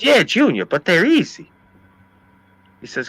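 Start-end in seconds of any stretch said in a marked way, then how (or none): 0.0-1.5 high, wheedling objection; imitated protesting cousin. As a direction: plead the case in a raised voice. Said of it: "he's going all nuts".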